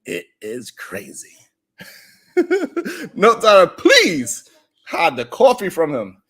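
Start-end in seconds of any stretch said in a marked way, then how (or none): 0.0-1.4 whispered aside. Real, not acted. Wry aside after the story.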